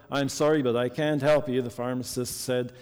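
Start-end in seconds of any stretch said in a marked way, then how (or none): none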